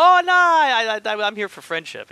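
high, loud hammed-up whine of fake innocence; mock Zarkov. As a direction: squeal it like a caught villain pleading. Mocking Zarkov's sudden friendliness.

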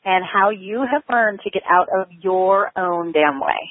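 ordinary talk

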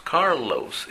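Carlos in an American accent with a long dark L and an English R. Shown as a demonstration. Teacher imitating a gringo saying his name. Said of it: "cuando lo pronuncia un gringo es Carlos".

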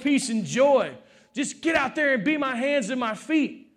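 high, fervent preaching voice. Real, not acted. Preacher urging church to serve each other, impassioned preaching. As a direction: preach it out, building in force.

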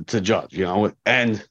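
normal talking voice

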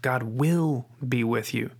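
"will" stretched and stressed in a slow phrase; slow delivery, weight landing on "will". Shown as a demonstration. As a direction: lean on "will" as a firm promise. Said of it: "no matter what word you put emphasis on".

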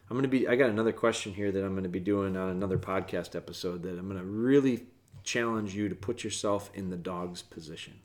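ordinary delivery

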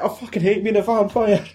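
high Scottish moan with laughter; comic Scottish lament; most Scottish accent. Retold or imitated. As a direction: complain in high, broad Scottish. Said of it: "in the most Scottish accent".

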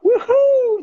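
high, loud celebratory whoop; joyful shout of celebration; excited whoop. Shown as a demonstration. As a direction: cheer it out like a big win. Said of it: "This is what it sounds like".

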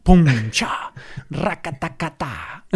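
mouth-made punch and gunfire sounds; vocal sound effects of a fight. Shown as a demonstration. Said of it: "este sonido lo incluimos". (efectos de sonido con la boca)

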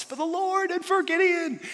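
high, loud rallying battle cry. Retold or imitated. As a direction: shout it charging into the camp. Soldiers screamed at top of lungs.

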